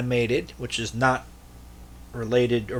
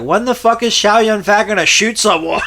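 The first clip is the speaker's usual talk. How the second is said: high, loud retold complaint; mock exasperated yell; 9 semitones higher, 6 dB louder than usual. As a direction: imitate an impatient kid viewer yelling at the film. Quoting his impatient childhood self.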